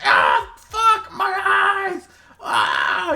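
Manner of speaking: high, loud shrieking creature; yell, leap into a shriek, yell again; pained goblin screaming. Acted. Goblin with blood in his eyes, screaming in pain. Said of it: "the goblin kinda, like".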